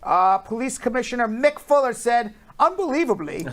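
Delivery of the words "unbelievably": higher-pitched, badly faked Australian accent; failed Australian accent. News host quoting an Australian police commissioner, bad Australian accent. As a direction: attempt an Australian accent and miss. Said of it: "I can't do an Australian accent".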